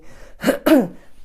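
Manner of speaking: loud rough clear of a sore throat. Real, not acted. Streamer with a sore throat clears her throat loudly. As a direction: clear the throat hard, then carry on. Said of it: "still got a bit of a sore throat".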